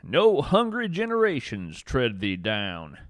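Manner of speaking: plain reading voice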